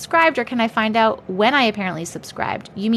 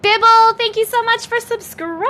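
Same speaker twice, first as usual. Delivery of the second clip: excited; 11 semitones higher, 4 dB louder than usual. Real, not acted. Thanking a new subscriber.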